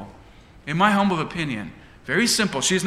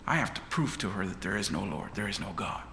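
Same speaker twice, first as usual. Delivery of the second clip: softer, lower musing; inner thoughts; 7 semitones lower, 8 dB quieter than usual. Acted. Neighbour plotting to himself, quiet and low. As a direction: say it to yourself under your breath. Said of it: "So this man is thinking".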